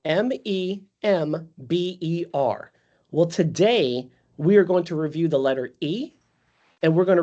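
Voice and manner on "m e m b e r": spelled out slowly. Shown as a demonstration. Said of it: "Everybody remember that in class?".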